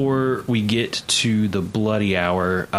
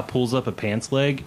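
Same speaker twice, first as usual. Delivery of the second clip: usual speaking voice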